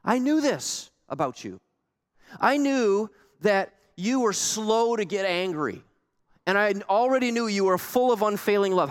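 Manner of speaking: a touch of bitter reproach toward God; mildly exasperated prophet; exasperated Jonah. Retold or imitated. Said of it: "He's quoting Exodus here".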